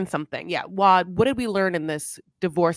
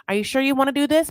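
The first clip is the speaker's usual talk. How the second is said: anxious pressing; 6 semitones higher, 3 dB louder, much faster than usual. Retold or imitated. Puppy anxiously pressing reluctant Eric.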